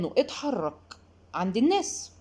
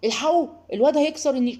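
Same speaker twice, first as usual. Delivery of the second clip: higher, louder mock alarm; alarmed shout; 5 semitones higher, 5 dB louder, faster than usual. Relatives warning about the china cabinet, hammed-up alarmed call. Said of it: "اللي هو ايه".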